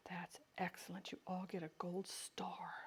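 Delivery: mock teacher whispering praise; whispered praise. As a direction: breathe the praise like a secret reward. Teacher praising imagined class.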